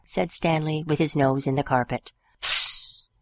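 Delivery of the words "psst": voiceless cat psst; a breathy pssst from the cat; whispered psst. A narrator's reading. Cat hushing the dog, voiceless psst. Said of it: "said the cat".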